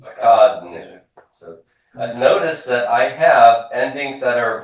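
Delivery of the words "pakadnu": loud and slow, evenly sounded out; repeat-after-me model. Shown as a demonstration. Teacher leading a class drill, slow loud model word. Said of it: "Repeat after me".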